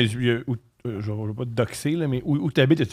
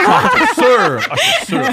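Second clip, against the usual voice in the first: laughing exclamation, much higher and louder; 6 dB louder, much slower than usual. Real, not acted. Friend laughing at the euro joke, laughing hard. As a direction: burst out laughing in agreement.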